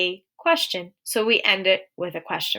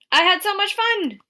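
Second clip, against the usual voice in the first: much higher, thrilled exclamation; excited exclamation; 11 semitones higher than usual. Shown as a demonstration. Teacher showing students an exclamation point, excited. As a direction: exclaim it high, bubbling with excitement. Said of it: "Exclamation point".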